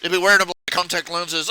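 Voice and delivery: usual speaking voice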